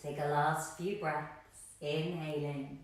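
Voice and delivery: calm, low-key breathing cues, letting phrases settle; guided meditation. Acted. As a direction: guide them gently into stillness, unhurried.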